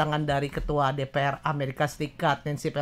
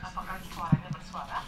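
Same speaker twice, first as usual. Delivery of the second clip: faint far-off echo of the voice; distant stream echo, much softer; echoed phrase repeats; 11 dB quieter than usual. Real, not acted. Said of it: "suaranya bergema ya".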